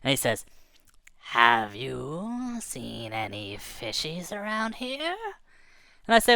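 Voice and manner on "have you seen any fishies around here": slow, softer menacing fish voice; slow, soft big-fish question. Acted. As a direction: drag it out like a lurking predator.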